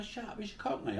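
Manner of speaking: high-pitched mock heckle; imitated dismissive retort. Acts out the others' retort.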